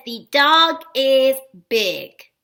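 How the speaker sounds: very slow, word-by-word reading. Shown as a demonstration. Teacher reads a practice sentence to children, slow read-out. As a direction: space out each word for early readers.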